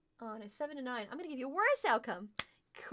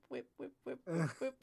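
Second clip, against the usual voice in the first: soft, low whip whip of a wagging tail; tail whip noises; 8 semitones lower, 4 dB quieter than usual. Acted. GM voicing the werewolf's tail, soft low whip sounds. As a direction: mimic a happy tail thumping softly.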